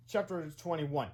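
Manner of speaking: ordinary delivery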